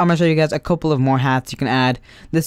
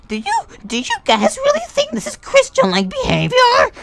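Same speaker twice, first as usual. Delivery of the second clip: squeaky, much higher mock teacher; shrill mock teacher moralizing at the students; 4 dB louder than usual. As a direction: ham up a preachy teacher, way up high. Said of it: "And she was like".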